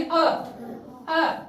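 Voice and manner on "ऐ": अ said as the open vowel of cat; short A sound. Shown as a demonstration. Teacher demonstrating A's short sound with an example, open अ. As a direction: give अ as an isolated 'aeh'. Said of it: "ए की short sound क्या होगी".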